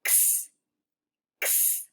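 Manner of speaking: x as a quick whispered ks; fast x sound. Shown as a demonstration. Teacher showing x said fast, a quick soft ks. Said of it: "if I say it really fast".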